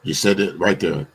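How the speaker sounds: ordinary talk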